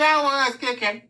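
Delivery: high, indignant little voice; mock whine; whiny kid protest. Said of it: "I was like".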